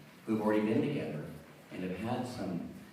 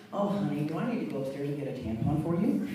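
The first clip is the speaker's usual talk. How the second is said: a touch higher, calm mock-sweet wife; wife's calm, teasing offer, lightly voiced higher; 5 semitones higher than usual. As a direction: sweetly needle the husband, calmly. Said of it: "my wife ... so very calm, collected".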